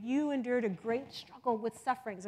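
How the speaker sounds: ordinary talk, nothing marked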